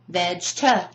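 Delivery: "Vegeta" as vej-tuh, two separate beats; "Vegeta" chopped, with no middle E; two isolated syllables. Shown as a demonstration. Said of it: "I break it down completely".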